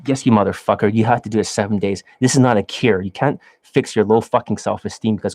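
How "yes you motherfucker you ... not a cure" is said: ordinary talk, nothing marked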